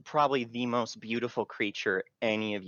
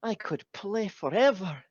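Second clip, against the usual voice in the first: high fairy voice; 7 semitones higher than usual. Acted. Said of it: "She's like".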